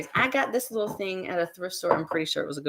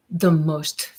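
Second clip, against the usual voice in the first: usual speaking voice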